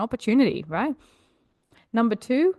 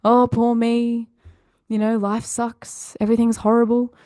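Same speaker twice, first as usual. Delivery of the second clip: mock self-pity; 3 semitones higher than usual. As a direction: imitate a very serious, self-pitying artist. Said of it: "If the artist is very serious".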